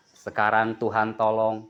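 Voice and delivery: ordinary delivery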